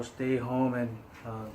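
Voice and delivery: ordinary delivery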